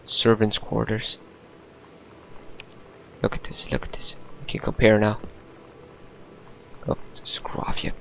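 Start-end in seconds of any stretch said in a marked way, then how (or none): 3.2-7.9 hushed unvoiced whisper to himself. Real, not acted. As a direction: whisper under your breath. Whispering while searching the room.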